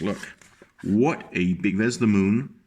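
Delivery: ordinary delivery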